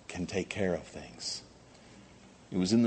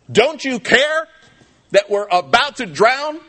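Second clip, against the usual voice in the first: high, loud terrified yell; panicked shout; 12 dB louder, slower than usual. Retold or imitated. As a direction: scream it over the storm. Disciples cry out in storm.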